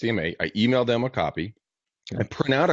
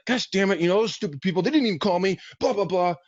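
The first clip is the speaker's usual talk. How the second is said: higher, half-serious griping; mock annoyed rant; 9 semitones higher than usual. Agent griping about clients who left, mock annoyed. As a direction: vent like you're mildly ticked off. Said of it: "got a little fucking pissed".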